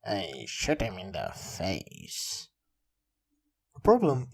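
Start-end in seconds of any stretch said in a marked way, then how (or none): 0.0-2.5 slow, low, softer character voice; interrupting player. Acted. Player cutting off the villain's speech, low and slow. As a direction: cut in with a drawn-out low voice. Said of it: "and the prologue just goes".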